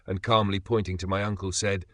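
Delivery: ordinary talk, nothing marked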